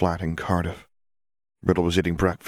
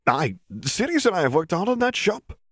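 put-on defensive young man, pitched higher; James voiced higher; 12 semitones higher than usual. A narrator's reading. Son defending his shop to his father, higher voice. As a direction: insist on your hard work.

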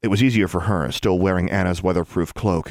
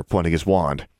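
ordinary delivery

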